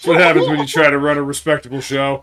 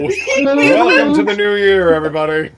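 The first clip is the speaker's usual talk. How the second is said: announcer voice; 9 semitones higher, 7 dB louder than usual. Acted. Announcer greeting.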